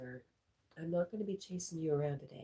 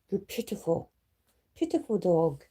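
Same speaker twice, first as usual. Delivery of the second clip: pet talk; 3 semitones higher, 6 dB louder than usual. Real, not acted. Teasing the dog fondly.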